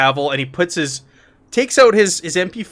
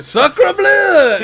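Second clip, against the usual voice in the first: put-on high, loud French-accented shout; 14 semitones higher, 7 dB louder than usual. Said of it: "you shout".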